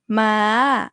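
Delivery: "ma" drawn out on an open vowel; dragged live syllable. Shown as a demonstration. Teacher shows ma could be dragged, long open ending. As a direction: stretch "ma" long, lifted in pitch. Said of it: "I could drag it".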